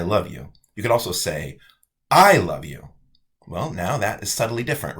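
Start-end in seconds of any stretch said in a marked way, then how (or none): 2.1-2.9 I punched loud; stress on I. Shown as a demonstration. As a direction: hit the word I hard, the rest falls away. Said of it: "that means I am the one who loves you".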